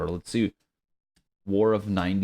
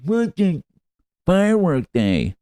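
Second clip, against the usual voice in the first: much higher, playful dumb-question voice; dopey search voice; 11 semitones higher, 5 dB louder than usual. Pretend. Host clowning on her googling show, high dopey voice. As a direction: ask it high and silly.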